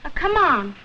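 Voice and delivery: usual speaking voice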